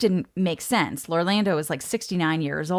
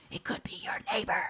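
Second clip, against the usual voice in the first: whisper; 11 dB quieter, faster than usual. Pretend. Spooky aside to listener.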